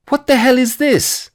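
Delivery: high, loud, exasperated retold outburst; outraged. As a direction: explode with disbelief at her. Finds passports in girlfriend's pocket.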